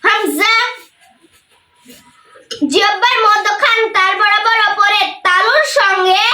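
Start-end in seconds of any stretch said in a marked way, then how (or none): 0.0-0.5 হামজা said short and clipped, no stretch; quick hamza. Shown as a demonstration. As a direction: snap হামজা out quickly without holding it. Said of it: "তাড়াতাড়ি পড়তে হবে".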